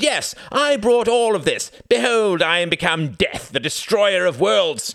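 very high, loud, grandiose proclamation; crying out. A narrator's reading. Reginald mockingly owning up to Wendy's accusation, crying out. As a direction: declaim it hugely, stretching the words. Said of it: "cried Reginald".

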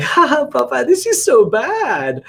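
put-on high, slow teasing child voice; teasing daughter. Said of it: "and she's like".

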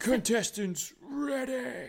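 booming game show announcer; announcer shout. Retold or imitated. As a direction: call it out like a TV host. Continuing the show's announcer call.

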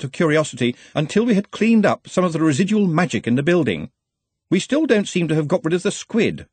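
high-pitched, insistent subordinate; earnest, fussy assistant. Acted. Ponder reminds Ridcully.

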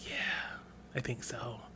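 hushed whispered agreement; whispering. Real, not acted. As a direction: drop to a whisper, conspiratorial.

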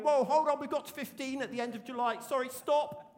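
mock alarm. An imagined leader halting the growth, mock panic. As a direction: shout stop as if the church must halt the work. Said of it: "nobody's going to be going".

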